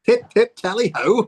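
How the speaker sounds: high, loud posh British caricature; hammed-up British toff cry; mock King Charles. Teasing co-host as royal, mock British accent. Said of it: "King Charles".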